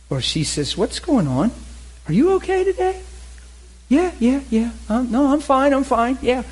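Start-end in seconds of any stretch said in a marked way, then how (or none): 0.8-3.0 concerned wife. Pretend. Wife on phone senses trouble. 3.9-6.4 forced reassurance. Pretend. Husband brushing off wife's worry.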